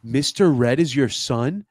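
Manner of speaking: ordinary delivery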